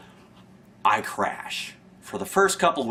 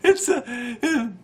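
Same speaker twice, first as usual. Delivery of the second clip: laughing; 12 semitones higher, slower than usual. Real, not acted. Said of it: "I can't do it".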